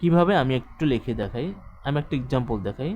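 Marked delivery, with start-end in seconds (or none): none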